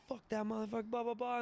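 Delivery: high mock rant, no real shouting; drunken fool ranting. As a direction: imitate yelling like a fool. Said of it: "being a fool".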